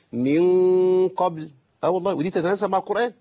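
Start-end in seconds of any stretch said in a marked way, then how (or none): 0.1-1.5 very slow من قبل, the n drawn out as a hum; hidden noon. Shown as a demonstration. Teacher showing how to say it, long hummed noon. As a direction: hold a long nasal hum on من before قبل. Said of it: "قلنا نقول إيه".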